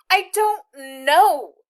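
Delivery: high, loud, very slow choked words; choked crying. A narrator's reading. Girl bursting into tears before the soldier. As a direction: force it out through tears. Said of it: "her voice choked".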